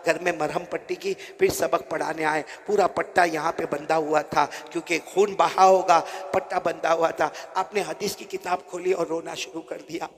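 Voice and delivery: normal talking voice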